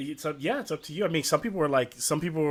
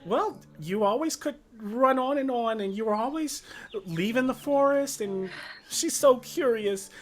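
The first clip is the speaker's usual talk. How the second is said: much higher pleading voice; anxious mother; 10 semitones higher, 4 dB louder than usual. Acted. Mother finally finds Fitz, high voice. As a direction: plead with a long-lost child.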